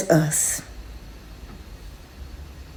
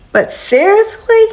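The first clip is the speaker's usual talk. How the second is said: can you believe this, rising high; incredulous; 10 semitones higher than usual. Real, not acted. Tenant fed up with landlord, incredulous high question. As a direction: pitch jumps way up on a disbelieving question. Exasperated at broken smoke detector.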